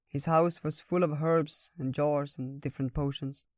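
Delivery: plain reading voice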